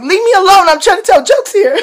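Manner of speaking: high, loud whiny plea; upset protest. Retold or imitated. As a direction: beg the hecklers to stop, half crying. Retelling pleading with hecklers.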